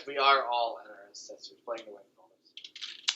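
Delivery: muffled, as if mouth is in a pillow; mumbling through a pillow. Real, not acted. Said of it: "You sounded like you're talking to a pillow".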